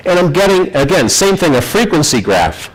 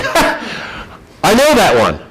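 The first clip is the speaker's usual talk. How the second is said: cocky; 7 semitones higher, slower than usual. Retold or imitated. Bragging to the gruff boss, eager and cocky. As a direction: brag that you know the error.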